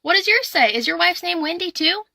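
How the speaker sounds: a man at the urinal greets a stranger, high and excited; excited tourist voice. Acted. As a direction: pipe up brightly to a stranger at the urinal. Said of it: "and he goes".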